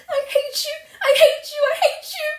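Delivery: shrieked high and loud, each one harder; stamping furious cries. Acted. Orphan girl stamping at the neighbour, repeated furious shrieks. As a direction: hurl it again and again, crying. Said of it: "A louder stamp with each assertion".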